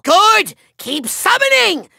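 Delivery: ordinary talk, nothing marked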